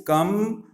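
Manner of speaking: "Come" lifted high as a lone beat; isolated syllable. Shown as a demonstration.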